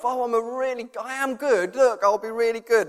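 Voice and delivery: high put-on voice of a keen schoolchild; eager good pupil. Dad mimics daughter putting on a show at school, high eager voice. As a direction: mimic a child showing off how good they are. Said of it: "putting on this show of".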